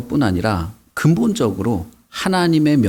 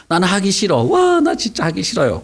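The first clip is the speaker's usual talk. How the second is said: put-on higher, whining kid who refuses; whiny child; 6 semitones higher than usual. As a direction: pout it out like a stubborn child. Voicing a reluctant child.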